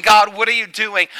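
loud, high exasperated plea; mock cry to God. Voiced complaint to God again.

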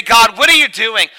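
loud, high exasperated plea; mock cry to God. Voiced complaint to God.

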